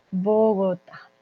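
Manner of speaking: drawn-out "Bogotá" with a long first o; unhurried demonstration of "Bogotá"; slow model pronunciation. Shown as a demonstration. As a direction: say "Bogotá" slowly for learners. Teacher models the word slowly.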